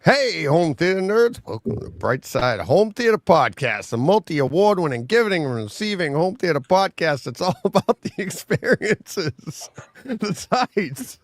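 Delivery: podcast intro. Acted. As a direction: open the show with big, swinging pitch and stretched words.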